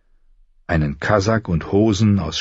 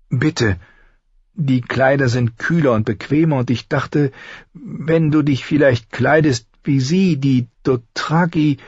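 higher, hesitant voice; pleading sister; 7 semitones higher than usual. A narrator's reading. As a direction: explain cautiously to a touchy brother. Sister timidly explains her gift.

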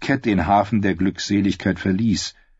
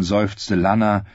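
plain reading voice

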